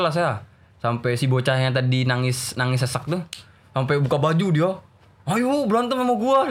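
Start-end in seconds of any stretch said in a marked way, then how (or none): 5.2-6.5 high, drawn-out dare to fight; hammed-up angry challenge; imitated fed-up challenge. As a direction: mimic a worked-up boy squaring up. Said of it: "Jadi kan kesel".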